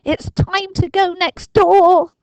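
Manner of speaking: put-on high, loud, slow resolute boy; determined Dinking. A narrator's reading. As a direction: announce the mission gravely.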